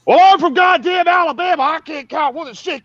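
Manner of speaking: high, loud hammed-up Alabama drill sergeant. Drill sergeant mocking new recruits in a high, loud voice. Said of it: "And he goes".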